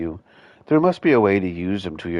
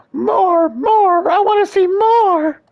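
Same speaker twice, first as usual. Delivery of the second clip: very high frantic shrieks; manic shrieking; 8 dB louder, slower than usual. Acted. Horseman gloating in frenzy.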